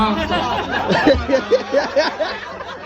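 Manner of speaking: laughing at himself; high burst of laughter. Real, not acted. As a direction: giggle. Laughing after the chant.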